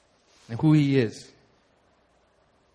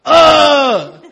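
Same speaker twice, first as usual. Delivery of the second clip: loud high gross-out yelp; disgusted groan; 10 semitones higher, 13 dB louder than usual. Retold or imitated. Friend on the field trip yelping loud in disgust. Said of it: "my friend goes".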